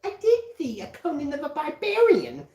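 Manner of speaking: high, playful cartoon parody; cartoon catchphrase voice. Mock-spotting Conan the Barbarian, high cartoon voice. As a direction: raise the pitch like a little cartoon character.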